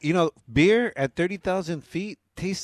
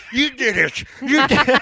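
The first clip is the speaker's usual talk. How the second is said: high, loud accusing yell; frantic shouting hostage taker; 8 semitones higher, 6 dB louder than usual. Pretend. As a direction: point and scream the blame. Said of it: "You're like".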